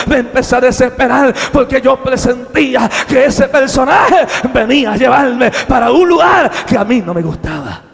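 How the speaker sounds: loud, urgent testimony; full-force preaching. Acted. Preacher recalling how he begged God for mercy, full-force preaching. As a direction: cry it out to the congregation.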